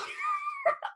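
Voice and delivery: short high giggle; high breathy laugh. Real, not acted. Laughing after needing water.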